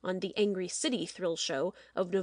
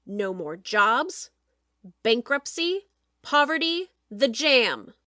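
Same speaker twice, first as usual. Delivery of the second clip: building loud warning; 5 dB louder, slower than usual. Acted. Producer warns staff of ruin, building louder. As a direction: pile on each word, building.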